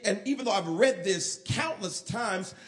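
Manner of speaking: ordinary talk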